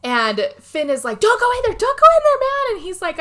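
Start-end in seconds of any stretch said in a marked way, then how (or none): 1.2-2.8 high, loud frantic shout of warning; panicked warning yell. Retold or imitated. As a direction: yell at him not to go in, terrified. Finn yells warning in retelling.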